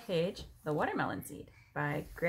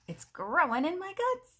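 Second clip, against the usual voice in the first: alarmed crocodile; 13 semitones higher, 4 dB louder than usual. Acted. Crocodile panics about growing seed.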